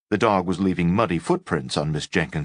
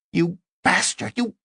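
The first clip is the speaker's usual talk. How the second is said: shrieking woman kicking the doctor. Acted. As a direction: shriek it while kicking him.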